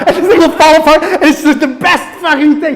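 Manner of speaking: delighted, cracking up; giddy laughter over a favourite scene; laughing. Real, not acted. Gleeful retelling of favourite moment.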